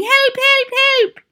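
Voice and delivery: very high, loud squeal for help; mock yelling. A narrator's reading. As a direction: witch mimicking panicked teachers. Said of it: "and yelling".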